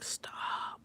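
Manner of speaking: soft breathy whisper; whispered stop. Retold or imitated. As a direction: whisper it flatly. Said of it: "I'm just like".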